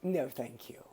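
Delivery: a hushed, polite brush-off; mild quiet decline; polite quiet refusal. Retold or imitated. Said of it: "And they're like".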